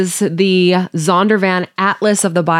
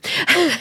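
short laugh; 10 semitones higher, 5 dB quieter than usual. Real, not acted. Laughs at own bad joke.

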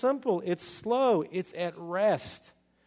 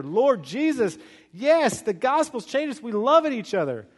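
high, loud fervent praise; acted zeal, pitched up; excited believers; 6 semitones higher, 4 dB louder than usual. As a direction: imitate an enthusiastic congregation. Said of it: "The Thessalonians are like".

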